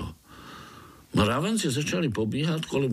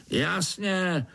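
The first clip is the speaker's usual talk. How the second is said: higher-pitched overseer voice; curt overseer; 8 semitones higher than usual. A narrator's reading. Overseer confirms to the spider, dismissive. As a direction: confirm it as obvious, pitched high.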